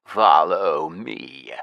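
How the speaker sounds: low, slow ordering voice; lich command. Acted. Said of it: "Shaz ordered yet again".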